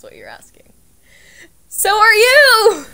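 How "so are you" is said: shouted, slow and high teasing jab; loud teasing. Real, not acted. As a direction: fire it back loud and drawn out. Teasing retort at friend.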